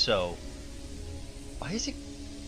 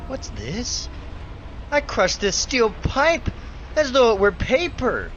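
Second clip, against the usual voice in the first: high, excited and breathless; amazed; 6 semitones higher than usual. Acted. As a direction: marvel at what you can do. Discovers his powers.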